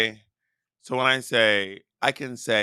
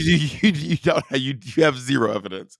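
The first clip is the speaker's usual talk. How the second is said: ordinary talk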